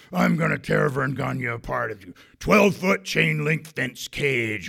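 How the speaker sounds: loud, high, snarling heel promo; wrestler's vile threats. Retold or imitated. Wrestler threatening the TV camera: loud menacing promo. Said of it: "Mad Dog starts making vile threats at the camera".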